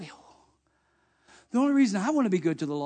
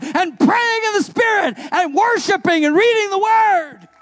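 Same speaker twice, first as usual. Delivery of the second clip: full-force preaching; 11 semitones higher, 10 dB louder than usual. Acted. Pastor rallying the faithful, preaching at full force. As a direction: preach it to the rafters.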